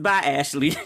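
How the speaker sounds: laughing "Bye, Ashley" through a giggle; laughing goodbye. Retold or imitated. Typing comments on a friend's wild posts, laughing. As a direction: say "Bye, Ashley" while cracking up. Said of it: "I just type in the comments".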